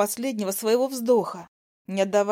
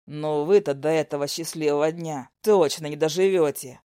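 low smirking voice; 6 semitones lower than usual. A narrator's reading. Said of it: "усмехнулся Гарри".

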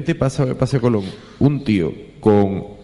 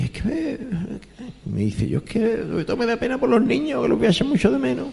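much higher, soft, tearful voice; mock weepy Cani; 13 semitones higher, 4 dB quieter than usual. The Cani crying to his friend over his girlfriend, mock weepy. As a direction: play an old man whimpering over his breakup. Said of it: "el Cani allí llorando".

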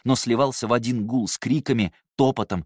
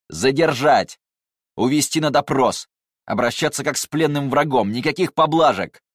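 shouted commands; 7 semitones higher, 7 dB louder than usual. Acted. Tribune shouting arrest orders.

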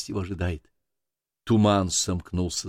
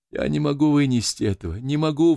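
higher, pleading distressed voice; a touch of tearful despair, pitched up; understated sobbing; 8 semitones higher, 3 dB louder than usual. A narrator's reading. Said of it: "всхлипывал Дэн". (сдержанно, будто всхлипывая)

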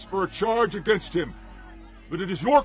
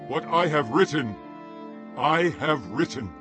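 deliberate, higher official voice; final refusal; 6 semitones higher than usual. Acted. Pilate refusing the priests' protest, firm finality. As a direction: refuse firmly, pausing between the halves.

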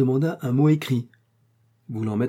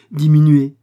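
loud, scoffing "diminuer"; wife mocking her husband; mocking laugh; 5 semitones higher, 12 dB louder than usual. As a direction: laugh the word away. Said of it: "répétait-elle".

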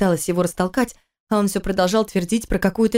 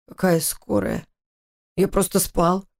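sullen indignation; slower than usual. A narrator's reading. Groggy husband grumbles back at his wife, sullen indignation. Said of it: "возмутился он хмуро".

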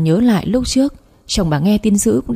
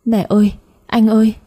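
mild mournful cry; 3 semitones higher than usual. Acted. Daughter crying over mother and brother, in a mild mournful call. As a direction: call out to family with restrained grief. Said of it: "gào khóc thảm thiết".

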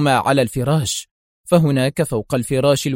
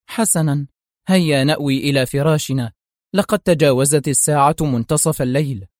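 plain reading voice

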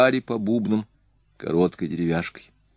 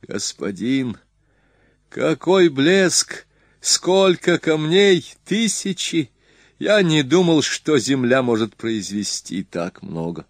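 cry of amazement; 6 semitones higher than usual. A narrator's reading. Poet gaping at Attila's chest of gems, amazed. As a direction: marvel aloud, stretching out the words. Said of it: "вырвался крик изумления".

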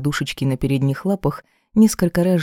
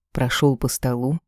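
ordinary delivery, nothing marked

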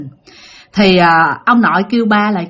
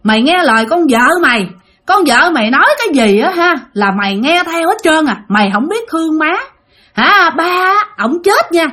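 higher-pitched retold scolding; mắng con trai; 4 semitones higher than usual. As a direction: tell him off in a raised voice, acting the aggrieved spirit. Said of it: "quay qua chửi ông Út". (giọng chửi trách cao, kể lại)

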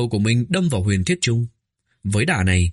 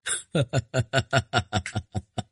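quiet laugh swelling slowly; growing evil laughter; 6 dB quieter than usual. Acted. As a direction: let the villain's laugh build. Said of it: "tiếng cười từ không thành có và ngày càng lớn hơn".

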